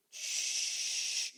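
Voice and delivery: loud unvoiced hiss of a cappuccino frother; imitated milk steamer hiss. Shown as a demonstration. Dad making cappuccino, loud steaming hiss. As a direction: sound out the steam wand. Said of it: "you know, like that".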